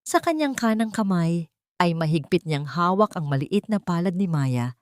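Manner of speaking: plain reading voice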